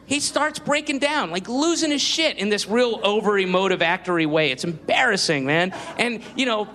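ordinary talk

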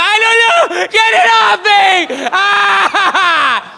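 high, loud panicked shriek; mock panic. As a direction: squeal it like he's terrified of the earring. Acting out panicked Jason.

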